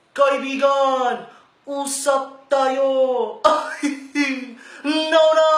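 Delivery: loud, slow, high hype call to the driver; hyped-up shout. Acted. Hype host shouting to the driver, loud and high. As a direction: yell out to your buddy with big energy.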